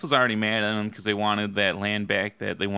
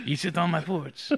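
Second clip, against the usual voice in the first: put-on French accent; 6 semitones higher, 3 dB quieter than usual. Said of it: "I made him French".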